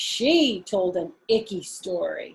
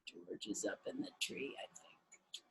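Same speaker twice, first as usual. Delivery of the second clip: hushed whisper to herself; 14 semitones lower, 22 dB quieter than usual. Acted. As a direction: whisper so George in the tree can't hear. Martha secretly notices George hiding.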